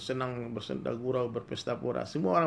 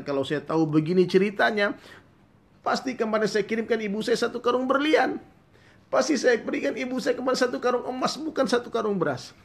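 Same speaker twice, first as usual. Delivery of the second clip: much higher, louder regretful wail; anguished lament; 12 semitones higher, 8 dB louder than usual. Acted. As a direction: cry out in bitter regret. Youth weeps in regret.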